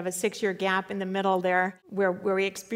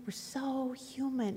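"We're so human" dropped soft and slow, leaning on "so"; hushed, rueful repeat; 3 semitones higher, 9 dB quieter, slower than usual. Shown as a demonstration. Preacher admitting human weakness to the congregation, soft and slow. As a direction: let "We're so human" fall away quietly.

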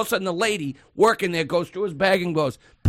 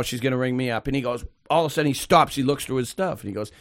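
ordinary talk, nothing marked

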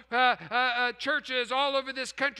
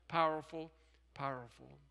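very low, soft echo; hushed repetition; 9 semitones lower, 9 dB quieter than usual. Real, not acted. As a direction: let the word fade away, low and soft. Repeats word for solemn effect.

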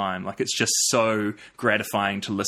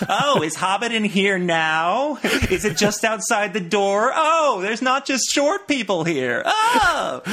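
high, loud, over-excited tourist squeal; hammed-up overexcited American visitor, far higher and louder; gushing American tourist; 11 semitones higher, 4 dB louder, slower than usual. Podcast guest mimics Americans getting off the plane, squealing excitement. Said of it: "that was a good impression".

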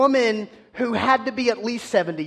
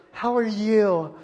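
sing-song morning cheer; 3 semitones higher, slower than usual. Retold or imitated. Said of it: "dudes that gets up out of bed in the morning and goes".

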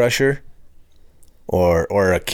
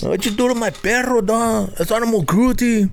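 much higher, put-on street accent; hammed-up tough guy; 13 semitones higher than usual.

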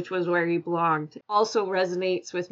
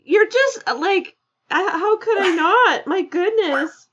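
laughing through tears; 11 semitones higher, 7 dB louder than usual. Real, not acted. Moved guest laughing through tears.

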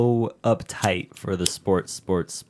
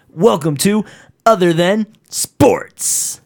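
high, loud arcade announcer, very drawn out; NBA Jam voice; 8 semitones higher, 6 dB louder, much slower than usual. Shown as a demonstration. Co-host recording the show intro, booming slow announcer voice. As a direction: blast the title slowly like a game announcer. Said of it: "Sega Genesis N B A Jam voice".

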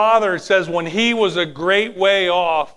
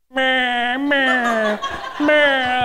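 higher bleating meh with a laugh; playful sheep bleat; 6 semitones higher, much slower than usual. Retold or imitated. Pastor imitates the found sheep, playful bleat. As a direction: bleat like a little lamb, playfully.

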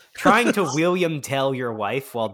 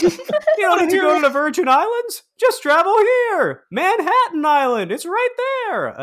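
high, loud standup voice; standup comedian parody; 7 dB louder than usual. Retold or imitated. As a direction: ham up a standup bit.

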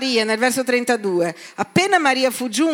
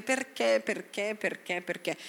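fading whine; 10 dB quieter than usual. Retold or imitated. Their mind questioning God.

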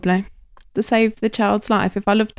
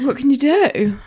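usual speaking voice

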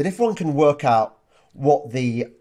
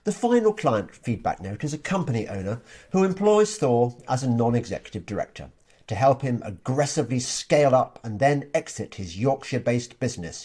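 usual speaking voice

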